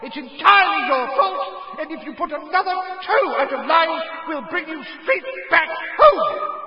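a mother's howler letter roaring at her son; furious mother yelling. A narrator's reading. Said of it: "Missus Weasley's yells".

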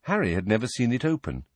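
ordinary delivery

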